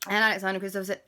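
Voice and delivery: ordinary delivery, nothing marked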